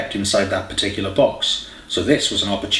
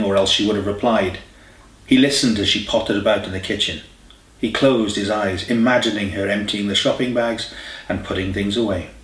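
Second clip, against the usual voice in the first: ordinary delivery, nothing marked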